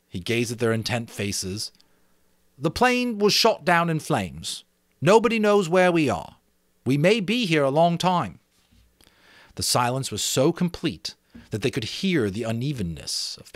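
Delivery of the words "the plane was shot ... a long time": put-on higher, louder boy's voice. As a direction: warn them it could be a long time. Ralph warns the boys.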